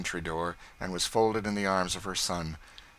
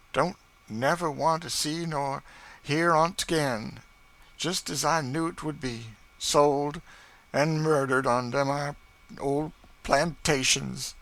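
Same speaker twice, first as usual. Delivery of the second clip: grief-stricken bitterness; 7 semitones higher, 5 dB louder than usual. Acted. Chloe learns husband died.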